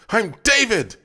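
high, loud furious yell. A narrator's reading. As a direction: yell it like a beast awakening. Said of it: "it yelled".

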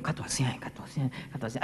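quick, very soft urging; hushed, rapid repeated prompt. Retold or imitated. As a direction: egg them on under your breath. Retells coaxing a crying follower.

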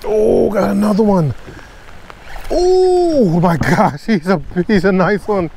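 excited. Real, not acted. Angler hooking another big bass in the rain, thrilled. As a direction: stretch out oh my gosh in delight.

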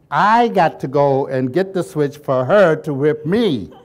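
loud, slow words through laughter; speaking loud while laughing. Real, not acted. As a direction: laugh it out as you tell it. Laughing at childhood memory.

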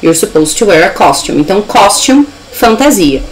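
ordinary talk, nothing marked

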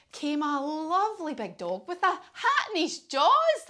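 usual speaking voice

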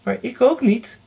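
put-on high, loud girl's cry; calling out. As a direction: shout your refusal too. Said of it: "riep Erika".

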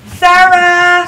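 loud, high summons; calling out. Real, not acted. Host calls the next player up, calling out. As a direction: holler the name across the room.

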